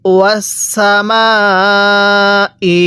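very slow ওয়াসামা with the vowel stretched, then ই; long drawn vowel. Shown as a demonstration. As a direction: hold the long vowel out fully before ই. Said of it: "আরেকবার আমার সাথে পড়ুন". (টান দিয়ে লম্বা করে ওয়াসামা ই)

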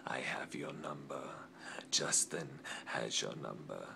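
low, hushed threat; menacing whisper. Acted. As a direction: whisper it like a promise of revenge. Threatening rival in promo.

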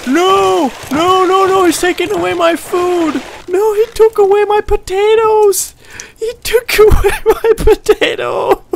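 high, loud shrieks of panic; panicked shrieking. Real, not acted. Gamer shrieking at the shark eating his potatoes. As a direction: scream as the shark steals your food.

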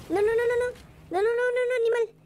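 rapid-fire high-pitched string of no's; high, urgent protest under attack; panicked pleading. Real, not acted. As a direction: blurt it out high in a gaming panic. Panics as opponent attacks him.

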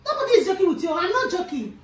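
high, loud angry warning; stern scolding. Acted. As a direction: raise your voice to show you mean it. Mother scolds the child.

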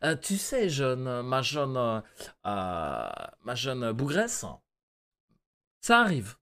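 lightly voiced pompous boss; péteux voice, snooty. Boss answering young employee, lightly snooty voice. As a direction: hesitate grandly, drawing out the last words. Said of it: "On va lui faire une voix de péteux".

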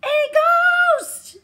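high terrified shriek; scream. A narrator's reading. Frightened animal screams a ghost. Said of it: "the animal screamed".

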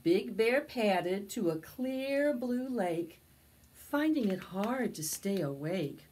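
put-on slow, lively read-aloud for children; storybook narration. A narrator's reading. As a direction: tell it to a circle of little kids. Storytelling continues.

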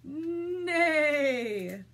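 loud, long, high whinny on the word; horse's neigh. Acted. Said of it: "what do horses say?".

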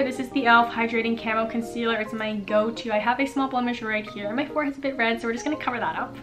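ordinary talk

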